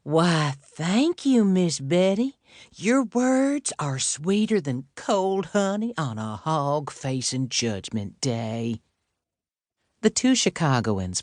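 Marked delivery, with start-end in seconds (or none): 0.0-8.8 faux Southern accent. A narrator's reading. Tilly answering Betty in an overdone Southern accent. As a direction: gush back like a hammed-up Southern lady. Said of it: "Tilly responded in kind".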